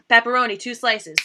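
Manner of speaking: loud order yelled at a busy New York pizza counter; shouted order. Retold or imitated. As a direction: call out your slice over the crowd. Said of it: "you're like".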